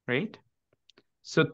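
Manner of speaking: ordinary talk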